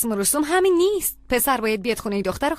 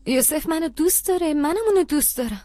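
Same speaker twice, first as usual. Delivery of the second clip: ordinary talk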